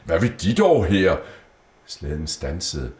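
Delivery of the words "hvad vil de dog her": high, loud startled cry; high, loud astonished exclamation; surprised exclamation. A narrator's reading. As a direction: caught off guard at the door. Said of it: "udbrød".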